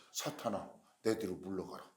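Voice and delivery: low, soft command; subdued rebuke. Acted. First, subdued take of rebuke. (낮고 조용하게 꾸짖는 목소리)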